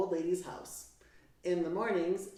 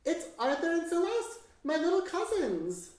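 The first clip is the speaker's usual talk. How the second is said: high and louder, delighted amazement; surprised and happy to see the cousins; astonished; 10 semitones higher, 4 dB louder than usual. Acted. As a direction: lift the names up in joyful disbelief. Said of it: "he says in astonishment".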